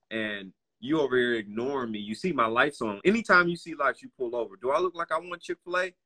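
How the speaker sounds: high-pitched, mock-exasperated scolding; indignant officer. Police officer scolding a driver in the parking lot, indignant. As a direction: imitate an officer's rising, rapid-fire indignant questions.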